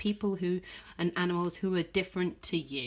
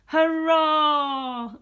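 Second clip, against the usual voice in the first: loud high hurrah; cheer; 7 semitones higher, 8 dB louder than usual. Acted. Reader cheering Pat for saving the day, loud. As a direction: cheer for the hero.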